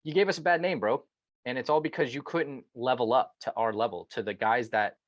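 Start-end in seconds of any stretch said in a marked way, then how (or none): 0.0-1.1 higher-pitched scolding with contempt; aggrieved, contemptuous "bro" put-down. Acted. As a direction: call him out with raised pitch and open contempt. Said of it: "so much contempt, because you're just like".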